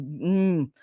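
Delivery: ordinary delivery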